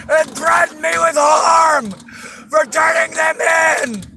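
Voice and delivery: high repeated cries for help; screaming for help. Real, not acted. Woman screaming help at officers. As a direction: call out desperately for help. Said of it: "I am literally screaming for help".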